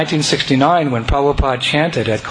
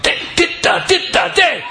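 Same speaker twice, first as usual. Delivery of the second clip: high, breathy call up to the player; urgent call. Retold or imitated. Speaker urging Vaibhavi to start playing, in a high call.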